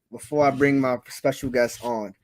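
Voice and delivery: usual speaking voice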